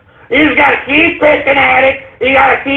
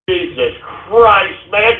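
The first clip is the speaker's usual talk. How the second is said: usual speaking voice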